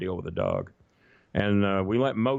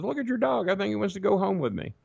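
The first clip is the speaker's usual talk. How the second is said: high-pitched stranger voice; 11 semitones higher than usual. Retold or imitated. Stranger quoted at McDonald's.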